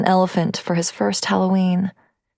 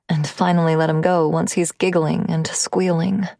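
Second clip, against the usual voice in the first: ordinary delivery, nothing marked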